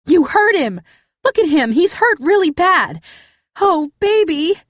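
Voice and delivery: high, loud shrill outrage; hysterical accusation. Acted. Cass screams blame at the narrator, high hysterical outrage.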